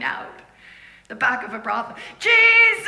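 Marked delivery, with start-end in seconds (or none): 2.2-2.9 high, loud call to Jesus; cry out. Retold or imitated. Girl in brothel crying out to Jesus, a loud high call. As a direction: shout the name out loud and high.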